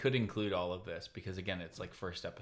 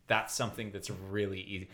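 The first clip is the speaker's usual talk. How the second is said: ordinary delivery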